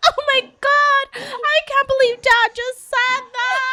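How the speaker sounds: put-on high, slow, shocked kid voice; shocked little sister. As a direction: gasp it out like a stunned little girl.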